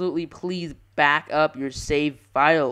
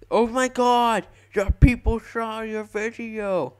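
excited fan voice; 6 semitones higher, 4 dB louder, slower than usual. Retold or imitated. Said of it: "I don't think he thinks anyone actually watches my video".

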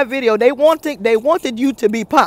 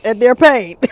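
ordinary delivery

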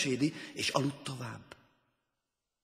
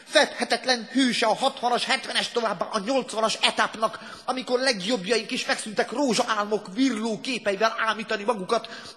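high, loud rally speech; howled oratory; 11 semitones higher, 8 dB louder than usual. A narrator's reading. Leader praising the hero to members below, howling. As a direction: keep howling the praise at full volume. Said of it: "süvöltötte a lenti tagoknak".

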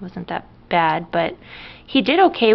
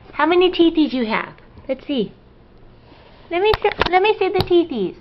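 high, singsong parent voice; 10 semitones higher, 3 dB louder than usual. Real, not acted. Parent asking baby to show teethies, playful baby talk.

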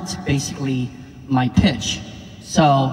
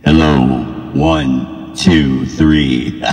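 very low pitched-down voice; deep DJ voice effect; much slower than usual. Shown as a demonstration. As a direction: drop the voice way down. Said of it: "D J singing".

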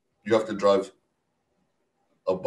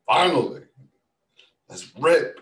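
loud, high burst of eagerness; retold eager relief; 7 semitones higher, 8 dB louder than usual. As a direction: let it burst out, raring to go. Retells eagerness once driving.

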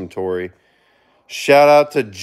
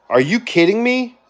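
higher, indignant outcry; outraged; 10 semitones higher than usual. Acted. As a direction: play up the disbelief and anger for the stream. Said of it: "That makes me angry".

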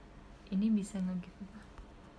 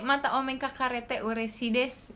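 ordinary delivery

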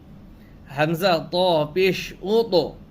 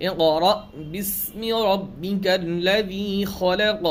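Quran recitation; slower than usual. Acted. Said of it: "যেমন একটা সূরা আছে না".

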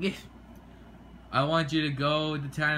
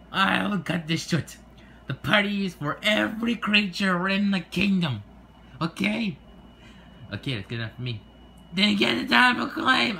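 impatient; 7 semitones higher, 5 dB louder than usual. Acted. King loses patience.

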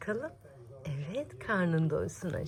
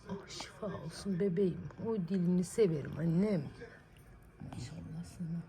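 ordinary talk, nothing marked

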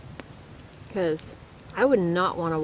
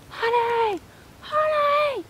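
imitated high-pitched scream; screaming for help; slower than usual. Husband screaming for his wife from the rocks at night, imitated scream. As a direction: call out in distress, far higher than usual. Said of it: "I hear him screaming".

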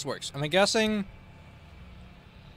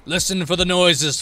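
louder, projected reminder; loud instruction; 5 dB louder than usual. Acted. Killer tells friends to listen for noises, called out. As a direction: call it out to the group.

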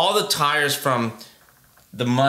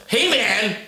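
higher, pressing "Hey, man."; urgent greeting; 7 semitones higher than usual. Shown as a demonstration. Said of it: "I was".

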